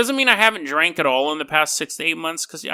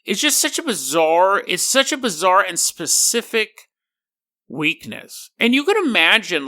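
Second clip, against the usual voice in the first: normal talking voice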